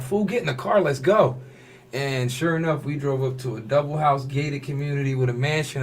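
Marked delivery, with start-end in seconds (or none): 0.0-1.4 eager friend. Retold or imitated. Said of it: "He's like".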